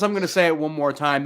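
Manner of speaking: usual speaking voice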